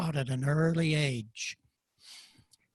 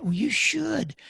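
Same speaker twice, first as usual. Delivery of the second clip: whispered aside; 9 semitones higher than usual. Real, not acted. Quiet aside.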